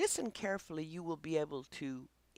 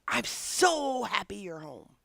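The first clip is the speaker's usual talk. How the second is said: slow, higher, louder happy dog; 4 semitones higher, 5 dB louder than usual. Pretend. Trainer voicing a dog greeting its owner, slow and joyful. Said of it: "And they say".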